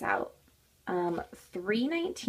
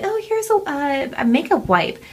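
put-on helpful girl; 6 semitones higher, 8 dB louder than usual. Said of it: "she was like".

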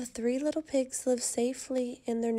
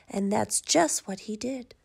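plain reading voice

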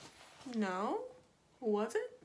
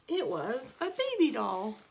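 ordinary delivery, nothing marked

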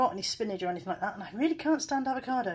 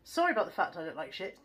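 normal talking voice

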